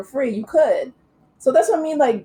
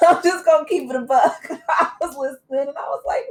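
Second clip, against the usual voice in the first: talking through real laughter, pitched up; laughing; 6 semitones higher than usual. Real, not acted. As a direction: laugh while owning up to a blunt opinion. Amused by the bad accent.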